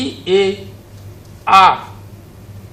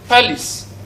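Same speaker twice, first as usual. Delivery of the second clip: ordinary delivery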